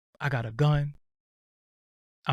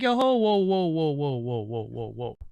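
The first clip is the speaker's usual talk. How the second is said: startled whoa. Retold or imitated. Calming a startled angry woman.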